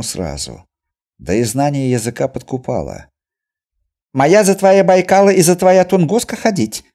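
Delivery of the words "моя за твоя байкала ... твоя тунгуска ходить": high, loud rattling trader; fast chatter. A narrator's reading. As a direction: rattle it off proudly. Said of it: "опять затараторил".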